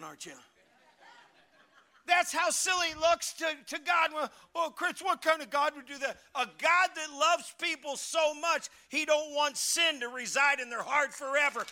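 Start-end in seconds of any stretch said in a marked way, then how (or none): 2.1-11.7 loud, high shouted sermon; full-force preaching. Acted. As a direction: drive the point home to the pews.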